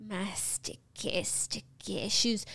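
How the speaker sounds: sticky shoes voice. Pretend. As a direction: breathe it out slowly and softly. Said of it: "Give us a little sticky shoes".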